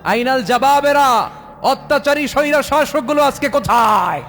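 preacher thundering at tyrants; thundering challenge. Acted. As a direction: roar it out like a king's summons. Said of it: "হুঙ্কার দিয়ে বলবেন".

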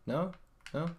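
ordinary talk

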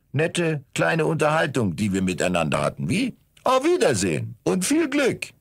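cheerful farewell. A narrator's reading. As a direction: wave off the stranger gaily.

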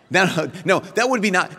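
normal talking voice

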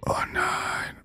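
breathy whisper of dismay, high and soft. Real, not acted. Gamer realizing his mistake, whispered oh no. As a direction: breathe it out in disbelief.

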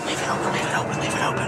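whispering. Real, not acted. Sneaking, not waking dad.

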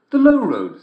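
put-on high young girl voice; girl's voice. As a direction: answer brightly like a little girl. Girl answers.

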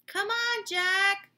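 loud, high call from below. Acted. Annie calls Jack down the ladder, loud and high. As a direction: call up to him from a distance. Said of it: "called Annie".

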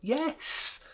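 put-on very high little-girl delight; joyous, high-pitched child; gleeful child. A narrator's reading. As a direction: pipe it up brimful of glee. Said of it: "child brimful of glee".

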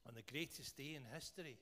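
low, soft prayer voice. Real, not acted. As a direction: pray aloud in a subdued, measured voice. Leads a prayer.

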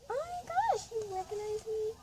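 high squealing [name] pretending to be recognized; [name] thrilled. Mom posing as [name] to the drive-through worker, squealing high. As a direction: squeal with delight as the fake star. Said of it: "I'm gonna first be [name]".

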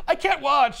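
hammed-up fake dismay; feigned horror. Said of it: "she's like".

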